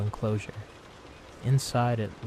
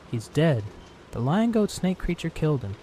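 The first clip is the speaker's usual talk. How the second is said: higher, louder, urgent telling of a killing; alarmed news; 6 semitones higher, 4 dB louder than usual. Acted. Telling the caged centaur the creature killed Johnny, rattled and urgent. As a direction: blurt it out, still rattled.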